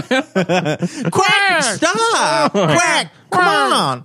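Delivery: high squeaky quacking voice; squeaky duck voice. Pretend. Voicing Curry as a duck.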